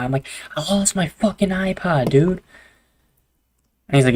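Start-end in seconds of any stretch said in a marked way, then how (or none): 0.5-2.4 higher, slower, drawn-out complaint; upset whine. Retold or imitated. Telling his uncle he lost the iPod, slow higher whine.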